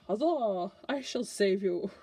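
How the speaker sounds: loud, heroic huzzah from a rescuer; hammed-up knight charging in to save; gallant Prince of Swords. Prince of Swords rushing to the rescue, heroic. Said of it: "I love how I give them voices".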